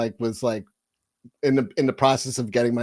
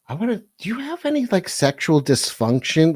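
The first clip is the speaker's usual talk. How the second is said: imitating mother; 5 semitones higher than usual. Voicing his mother.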